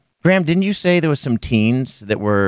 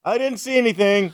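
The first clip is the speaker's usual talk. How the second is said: high, hushed fake innocence; awkward denial; 9 semitones higher, 4 dB quieter than usual. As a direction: pretend you saw nothing. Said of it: "the one guy goes".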